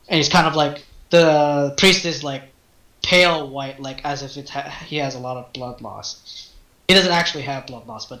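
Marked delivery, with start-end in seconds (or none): none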